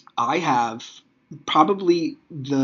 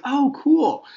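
much higher, louder put-on cheer; feigned enthusiasm; 13 semitones higher, 6 dB louder than usual. Dad hears kids' plan with Mommy, feigned enthusiasm. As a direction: cover dismay with a high, cheery reply.

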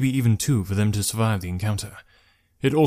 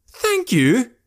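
high, loud, incredulous outburst; shouting; 13 semitones higher, 5 dB louder than usual. A narrator's reading. Rescued traveller scoffing at his rescuer, shouting. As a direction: throw it back as an outraged question. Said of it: "Now Jekrom was shouting".

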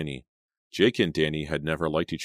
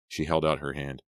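ordinary delivery

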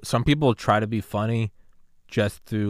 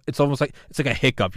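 usual speaking voice

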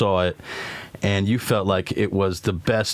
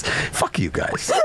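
laughing; 4 semitones higher, much slower than usual. Real, not acted. Laughing at friends leaving.

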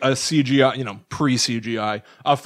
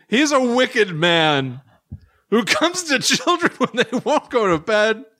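raised pitch, drawn-out spooky telling; dramatic story reading; 7 semitones higher, slower than usual. A narrator's reading. Reading Hoffmann's wicked Sandman tale to co-host, dramatic storytelling. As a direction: read it like a grim cautionary tale, higher and measured.

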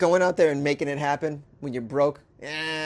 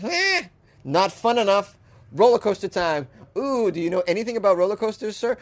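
thief voice; 5 semitones higher than usual. Pretend. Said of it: "This guy's like".

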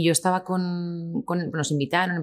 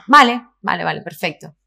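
bluffing confidence; 3 semitones higher, 9 dB louder than usual. Retold or imitated. Actress hiding her ignorance at the casting, brightly bluffing. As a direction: cover ignorance with over-eager agreement. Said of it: "para que no notaran mi falta de conocimiento".

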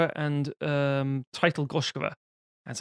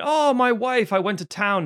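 happy husband; 6 semitones higher, 3 dB louder than usual. Retold or imitated. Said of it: "big and bright and bold and happy".

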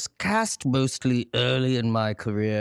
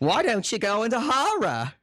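high-pitched mock agent voice; Bernie impression; 10 semitones higher than usual. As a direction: imitate an agent pitching a career move, voice raised high. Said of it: "That was my Bernie impression".

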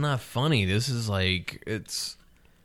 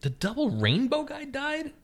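surprised; 4 dB quieter, slower than usual. Real, not acted. Hearing news of death.

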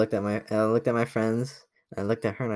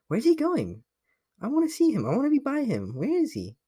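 much higher voice, mock needy girl; imitating the girl. A much higher mock girl voice, imitating her watching him. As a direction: squeak it out like a clingy girl. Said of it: "she'd always be just watching me, like".

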